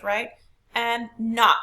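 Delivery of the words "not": NOT said loud and firm; stress on NOT. Shown as a demonstration. Teacher asking class if it takes stress, stressed. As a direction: punch the word "not" as a stressed syllable. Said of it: "Do we raise our pitch?".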